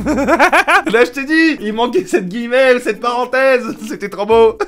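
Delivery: giggling, high; laughing aside. Real, not acted. Laughing about the cheesy line. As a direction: crack up at the text.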